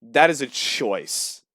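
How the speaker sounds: slow, deliberate punchline; slow deadpan. Real, not acted. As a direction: draw out each word for the joke. Punchline about the Florida man.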